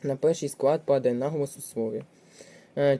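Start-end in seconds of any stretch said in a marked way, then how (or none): none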